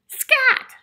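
loud, very high shoo; shooing shout. Acted. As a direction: shout it to chase off a pest. Duck shooing the bee.

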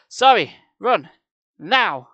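loud, urgent command; screamed. A narrator's reading. Boy urging Sari past the mummy, screaming. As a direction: yell it in separate bursts. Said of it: "I screamed".

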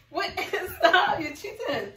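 loud laughing stop; laughing protest. Real, not acted. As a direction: yell through laughter. Marshmallows hitting face.

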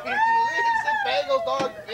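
mock shout. Chef scolds assistant.

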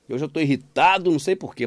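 usual speaking voice

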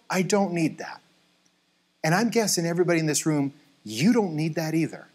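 ordinary talk

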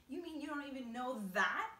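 loud, high mocking question; incredulous cry. A narrator's reading. Centipede jeering at James's ignorance, loud incredulous cry. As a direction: cry out in disbelief, building to the end. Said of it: "cried the centipede".

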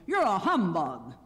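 forceful, mildly hurt accusation; grieved accusation. A narrator's reading. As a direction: accuse him with wounded force. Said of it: "in a grieved tone".

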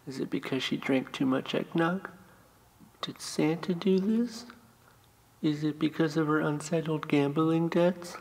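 high-pitched imitation of the children's questions; questioning kids. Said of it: "I'm sure they'll have questions".

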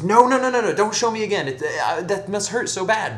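loud, high, alarmed pleading; panicked protest. Acted. Priest begging the hunchback not to show him again, panicked. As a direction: blurt it out high to stop him. Priest refuses a second demonstration.

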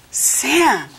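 dismayed cry. A narrator's reading. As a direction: cry out the name, mildly alarmed. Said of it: "cried Nina".

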